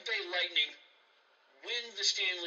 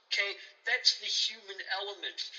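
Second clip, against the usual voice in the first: usual speaking voice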